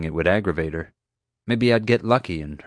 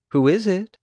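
sweetly; 12 semitones higher, 3 dB louder than usual. A narrator's reading. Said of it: "I asked sweetly".

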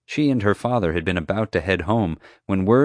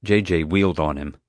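ordinary delivery

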